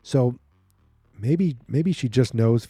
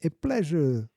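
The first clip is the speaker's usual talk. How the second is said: much higher, put-on sinister greeting; villainous doctor voice; 6 semitones higher than usual. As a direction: purr the welcome like a cold villain. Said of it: "I can't help but do the voice".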